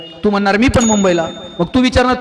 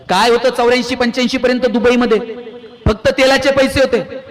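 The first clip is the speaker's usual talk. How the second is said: ordinary talk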